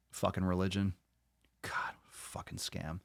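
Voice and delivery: hushed, disgusted grumble; muttered disgust. Real, not acted. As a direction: mutter it very softly, nearly voiceless. Disgusted aside about religion.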